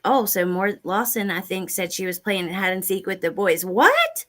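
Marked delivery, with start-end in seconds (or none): 3.6-4.3 high squeaky What? of disbelief; a sudden shocked high What?. Real, not acted. Shocked at the claim.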